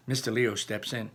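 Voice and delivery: plain reading voice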